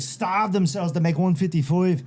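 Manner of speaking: put-on high, cocky Irish accent; Conor McGregor. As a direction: taunt in a put-on Irish brogue. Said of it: "He's like".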